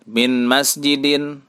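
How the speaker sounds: "Min masjidin" drawn out slowly, closing on -in; correct tanwin ending. Shown as a demonstration. Teacher modelling the reading without al, "Min masjidin" ending in -in. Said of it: "Tidak dibaca min masjidun".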